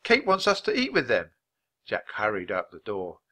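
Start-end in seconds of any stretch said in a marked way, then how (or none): 0.0-1.3 put-on high-pitched girl calling. A narrator's reading. Girl relaying a lunch invitation, calling out. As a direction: call the news through the door. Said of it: "Annie called outside the hut".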